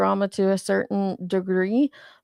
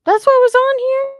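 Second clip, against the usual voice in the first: surprised; 8 dB louder than usual. Real, not acted. Reacting to a chat message.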